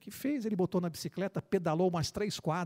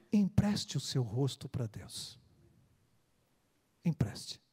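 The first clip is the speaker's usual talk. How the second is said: solemn appeal; 5 semitones lower, slower than usual. Real, not acted. Closing appeal in sermon.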